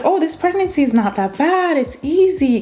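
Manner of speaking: high-pitched, carefree past self; breezy overconfidence. Retold or imitated. Recalling how easy early pregnancy seemed, breezy and high. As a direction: quote yourself lightly, pitched well up.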